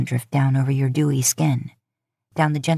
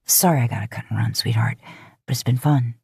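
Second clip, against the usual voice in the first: quick low apology; 5 semitones lower than usual. Acted. Dean apologizing before leaving.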